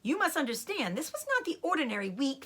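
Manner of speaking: ordinary delivery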